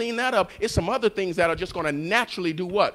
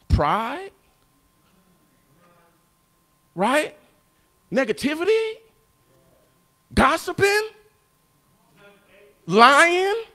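building list; 7 semitones higher, 4 dB louder, slower than usual. Real, not acted. Preacher listing sins, rising.

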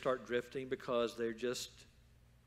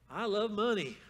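higher-pitched mock declaration; imagined money lover; 8 semitones higher, 5 dB louder than usual. Imaginary self-stabber answering a passerby, blithely declaring it higher. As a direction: play the eager self-harmer, pitched up. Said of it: "an imaginary dialogue where you meet someone".

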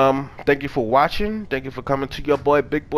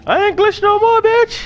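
much higher, louder gloat; triumphant shout; 6 dB louder than usual. Real, not acted. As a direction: crow it out in triumph.

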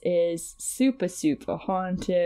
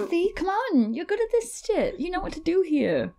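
high-pitched cheering; 11 semitones higher than usual. Acted. Urging Buffy on screen.

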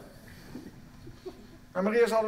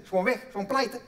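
ordinary delivery

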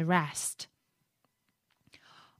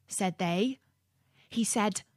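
ordinary delivery

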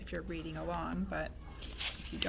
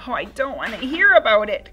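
crying out; 4 semitones higher, 12 dB louder than usual. Acted. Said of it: "cried George".

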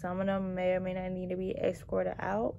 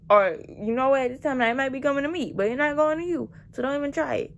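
loud, exasperated lecture to a stalling customer; scolding rant; 8 dB louder than usual. Retold or imitated. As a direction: put him in his place, fed up.

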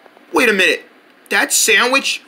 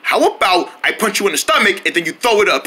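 usual speaking voice

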